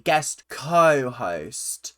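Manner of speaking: "co-host" drawn right out on CO; stress on co-host. Real, not acted. Repeating the correction to Abby, stress on "co-host". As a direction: lean slowly on the CO of "co-host". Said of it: "Let me just make that clear".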